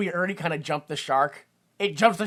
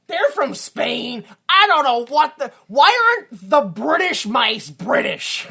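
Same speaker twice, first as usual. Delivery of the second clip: high, loud, drawn-out disbelief; comic outraged shouting, pitched up; exasperated rant; 8 semitones higher, 7 dB louder, slower than usual. Real, not acted. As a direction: rail at the absurdity, stretching the words out. Ranting at the film's nonsense.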